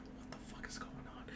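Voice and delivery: unvoiced spooked whisper. Seeing the tentacle drawings, a spooked whisper to himself. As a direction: breathe it under your breath, spooked.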